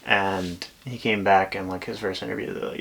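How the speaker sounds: normal talking voice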